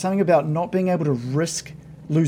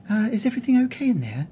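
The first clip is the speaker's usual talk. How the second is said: quick, higher concerned maid voice; a worried maid calling through the door; 8 semitones higher, much faster than usual. Acted. Maid calling from behind the door, quick higher concern. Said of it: "you just hear a voice".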